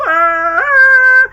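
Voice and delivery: a sudden shocked shriek; mock panic scream; freaked-out scream. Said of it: "Poe freaks out, where he goes".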